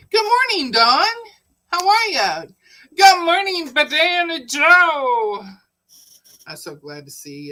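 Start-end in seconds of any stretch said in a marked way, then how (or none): 0.1-2.4 high, loud happy hello; greeting Dawn in the chat, high and loud with delight; excited greeting. Real, not acted. Greeting a viewer on stream. 2.9-5.4 high, loud, slow drawn-out hello; greeting Banana Joe in the chat, high, loud and slow; playful greeting. Real, not acted. Greeting a viewer on stream.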